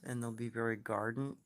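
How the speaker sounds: ordinary delivery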